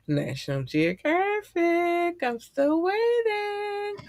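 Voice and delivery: very high, slow, sing-song; mock high calling. Calling out to National Geographic, high drawn-out sing-song.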